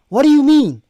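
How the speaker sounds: high, loud challenge; incredulous demand. Real, not acted. Reactor challenging the clip's claim, incredulous. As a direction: demand an explanation, pitched right up.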